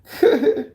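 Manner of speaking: short, high-pitched laugh; high giggle. Real, not acted. Laughing at how crazy it was, high giggle. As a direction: burst out giggling.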